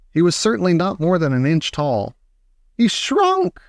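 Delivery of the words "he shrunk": shocked. A narrator's reading. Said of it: "said Mister Teavee".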